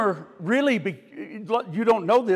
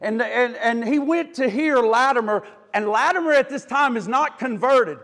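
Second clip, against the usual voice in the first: ordinary talk, nothing marked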